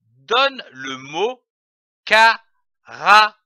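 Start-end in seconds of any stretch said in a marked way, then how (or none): 2.0-3.4 "carafe" split into ca, ra, fe with pauses; loud teacher's breakdown of "carafe": ca, ra, fe; syllable by syllable. Shown as a demonstration. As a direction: sound out "carafe" as three separate syllables, ca - ra - fe. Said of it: "L'association de ces syllabes".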